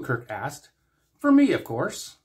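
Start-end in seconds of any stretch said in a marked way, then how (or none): 1.2-2.2 high, pleased and louder; lightly voiced Trelane. A narrator's reading. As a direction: purr it as if it's obvious. Trelane, delighted.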